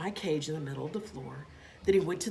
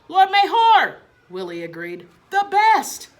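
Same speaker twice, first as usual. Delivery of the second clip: much higher and louder gleeful shout; excited cheer; 13 semitones higher, 13 dB louder than usual. A narrator's reading. Boy cheers for his father, excited.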